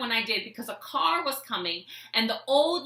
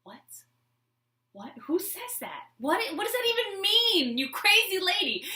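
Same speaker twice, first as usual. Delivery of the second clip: mock baffled; 9 semitones higher than usual. Voicing the old man's thoughts.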